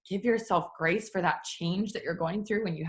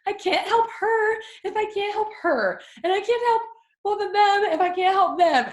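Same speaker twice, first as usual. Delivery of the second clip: high, loud, frantic mock panic; higher and louder, spiralling into overwhelm; 13 semitones higher, 5 dB louder than usual. Frazzled helper spiralling about who to help, loud and high.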